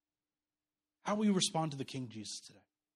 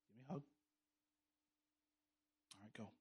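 soft father; 6 semitones lower, 20 dB quieter, much faster than usual. Retold or imitated. Father gently dismissing his child.

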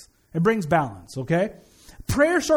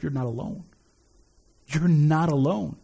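reassuring; 6 semitones lower than usual. Acted. Tender reassurance to congregation.

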